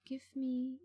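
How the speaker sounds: normal talking voice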